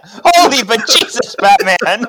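high, loud hammed-up exclamation; mock startled cry. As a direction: shout it like a campy sidekick. S0 repeats quote.

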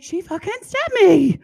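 hammed-up disbelief; mock shocked outrage. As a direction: act the stunned stabbed guard. Voicing the stabbed guard.

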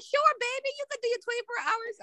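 put-on high, sugary sure baby; a kind elder humoring her; sweet church lady.

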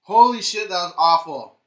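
loud pained outburst; burned tongue. Real, not acted. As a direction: react to a burning mouth. After the hot chip.

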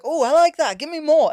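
loud, high and greedy; eager. Retold or imitated. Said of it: "and you're like".

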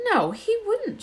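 a shocked schoolgirl defending a teacher; Hermione objecting. Acted. Hermione insists to Harry and Ron that Snape wouldn't. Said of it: "she said".

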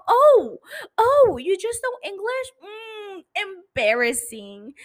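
high, loud mock shock at a listener; condescending mock. Teasing the English-only listener with mock pity. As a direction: look down on them with a gasp.

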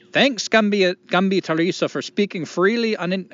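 high, loud, badly faked Irish accent; attempted Irish accent. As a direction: read it out in an amateur Irish lilt. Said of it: "I cannot do an Irish accent".

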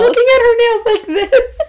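high, loud, breaking into laughter; laughing. Real, not acted. Mom describing her toddler admiring nails, laughing.

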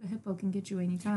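quick, soft mock-British monocle man; lightly put-on British gentleman; British accent. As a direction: toss it off in a quiet British voice. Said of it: "I imagine them as British also".

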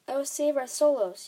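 furious, despairing exclamation; anguished cry. A narrator's reading. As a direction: wail it out in fury and anguish. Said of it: "he cried".

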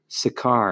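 Sakar stressed on its final syllable, sa-KAR; stress on last syllable. Shown as a demonstration. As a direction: say Sakar the Hebrew way, landing on "-kar". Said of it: "So put the last one".

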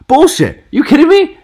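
very high, loud shouts of disbelief; outraged yell. Real, not acted. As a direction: erupt in furious disbelief. Outraged at her apology quote.

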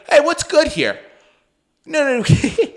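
high and bright, mocking a chatty friend; mocking zany chatter. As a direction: parody your friend as an over-friendly zany guy. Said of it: "You know, like, zany guy".

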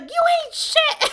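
high, slow, loud mock shout; hammed-up drunk screaming back; drunk friend's shriek. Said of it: "she was like".

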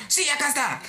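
high-pitched, insistent customer voice; high mock-belligerent retort; belligerent caller. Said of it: "seguía con esa actitud beligerante".

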